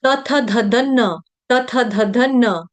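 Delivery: तथ धधन spelt out slowly, syllable by syllable; slow demonstration of धधन closing on न; halant na example. Shown as a demonstration. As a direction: intone तथ धधन deliberately, landing on the न. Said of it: "आ गया आपका हलंत न".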